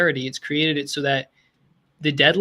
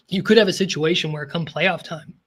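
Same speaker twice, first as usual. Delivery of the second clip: ordinary talk, nothing marked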